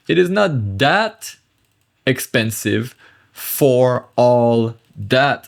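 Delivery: slow, spaced-out translation with pauses; word by word. Shown as a demonstration. Teacher translating French for learners, slowly, word by word. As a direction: spell out the meaning one chunk at a time.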